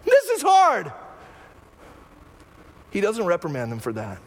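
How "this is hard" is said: pained cry to Jesus. Pretend. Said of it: "coming to Jesus and going".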